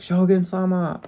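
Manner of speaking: put-on loud high Japanese accent on Shogun-sama; hammed-up anime Japanese Shogun-sama. Posing for photos, loud mock anime Shogun-sama. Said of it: "Sorry, accent".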